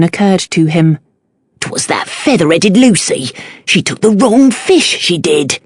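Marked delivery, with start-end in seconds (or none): 1.6-5.6 lowered Cockney fishmonger voice; defensive Cockney shopkeeper; Cockney accent. A narrator's reading. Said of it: "thick Cockney accent".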